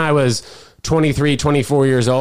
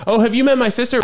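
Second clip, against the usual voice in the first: higher-pitched imitation of an eager girl; bubbly retold greeting; 9 semitones higher, faster than usual. Girl introducing her sister backstage, bubbly. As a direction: lift the pitch for the quote.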